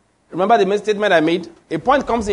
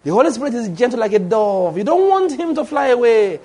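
mock pious cooing; 4 semitones higher than usual. Preacher mimicking worshippers afraid the Spirit flies away, mock-pious. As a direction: coo it with exaggerated holy gentleness.

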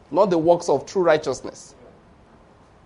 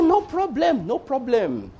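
mock dismissal; 7 semitones higher, slower than usual. Mocking reply to the boaster.

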